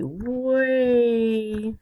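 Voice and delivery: WAY stretched long, high and loud; drawn-out WAY. Real, not acted. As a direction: stretch out "way" to show great distance. Stressing how far away Alaska is.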